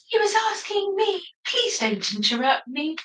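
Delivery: high, huffy bear voice; Elsa Bear voice. Acted. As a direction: snip back at the other bear, pitched high. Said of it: "which voice to do".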